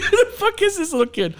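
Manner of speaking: high mocking disbelief, laughing; incredulous squeak. Dad at the concert, squeaking at the kid drummer in disbelief.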